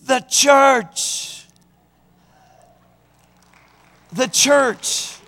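high, proud answer; the comedian answering the lady proudly; proud punchline. Retold or imitated.

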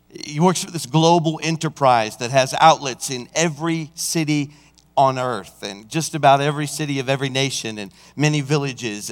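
ordinary talk, nothing marked

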